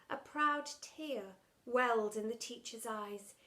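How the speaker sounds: plain reading voice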